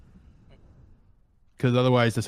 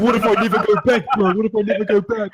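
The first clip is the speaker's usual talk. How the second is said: high, fast, giggling what-if; laughing panic; 9 semitones higher, 4 dB louder than usual. Retold or imitated. Friend fearing he'll stay high forever, high laughing panic. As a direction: panic through laughter, pitched way up.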